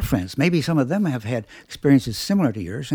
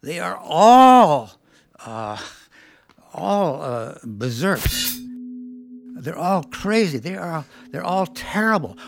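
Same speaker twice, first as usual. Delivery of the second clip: loud, slow and indignant, each word dragged out; retold woman fed up with men, loud and drawn out; exasperated rant; 4 semitones higher, 6 dB louder, slower than usual. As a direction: vent about every man she's met, exasperated. Woman vents about all men.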